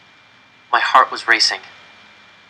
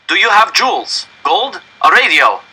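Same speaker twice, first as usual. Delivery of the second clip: demanding German officer; 8 semitones higher, 5 dB louder than usual. Acted. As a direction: press them for valuables. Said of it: "he demanded".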